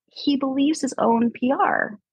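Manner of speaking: slow hushed aside; slow whispered jab at the boss; confiding whisper. Real, not acted. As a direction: whisper it as a secret.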